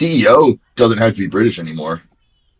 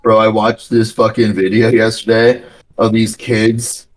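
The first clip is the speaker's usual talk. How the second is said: ordinary talk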